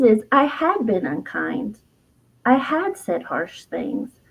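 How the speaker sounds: usual speaking voice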